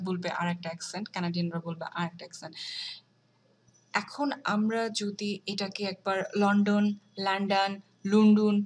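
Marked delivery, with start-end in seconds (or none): 6.4-8.5 লন্ডন said in a different accent each time, the last one louder; লন্ডন in clashing British, American and Australian accents; mixed accents. Shown as a demonstration. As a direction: hop between accents on লন্ডন. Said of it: "এরকম করে বলি, তাহলে বিষয়টা কেমন হয়ে গেল".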